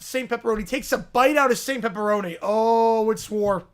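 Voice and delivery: ordinary talk, nothing marked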